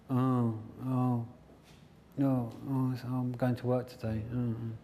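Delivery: groaning, put-on reluctance; mock morning dread. Coach acts waking up for work, groaning reluctantly. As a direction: moan like you can't face the day.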